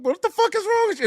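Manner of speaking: hammed-up shocked husband; mock outraged squeal. Husband reacting to wife's short skirt, in a high mock squeal.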